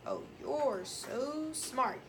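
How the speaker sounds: slow, drawn-out sarcastic take. Shown as a demonstration. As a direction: drag it out, dripping sarcasm. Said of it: "She said it in a sarcastic manner".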